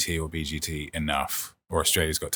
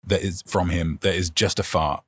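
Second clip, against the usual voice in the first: ordinary delivery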